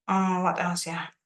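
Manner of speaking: normal talking voice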